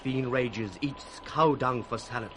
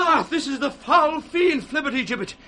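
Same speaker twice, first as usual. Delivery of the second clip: mad raving; 12 semitones higher, 8 dB louder than usual. Acted. Poor Tom raving about fiends.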